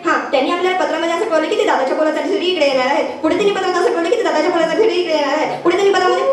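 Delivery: rapid-fire letter reading, looping; stuck record. Acted. Son reading the letter to father, stuck and rapid. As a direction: rattle it off quickly on repeat like a jammed needle. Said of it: "तुझी pin अडकली".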